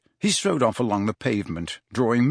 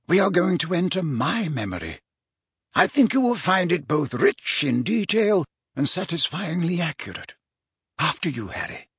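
measured mentor; 7 semitones higher than usual. Acted. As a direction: invite the pupil in like a courteous mentor. Wizard invites pupil into memory.

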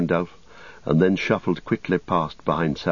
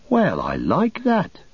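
high, slow, swooping outrage; indignant; 11 semitones higher, slower than usual. Acted. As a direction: exclaim it in affronted disbelief. Pippin indignant at being called thief.

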